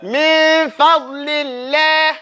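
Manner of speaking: very slow min fadlillah; drawn-out recitation. Shown as a demonstration. Teacher showing the difference to the class. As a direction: recite min fadlillah slowly, light lam. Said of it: "You hear the difference?".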